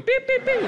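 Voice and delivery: high mock phone beep. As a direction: squeak out the dial tone beeps. Imitating the hang-up tone.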